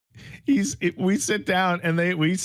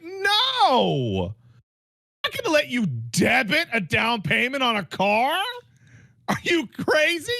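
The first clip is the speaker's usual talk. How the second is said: exaggerated disbelief; 6 semitones higher than usual. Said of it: "This is not how they sounded. This is how they meant".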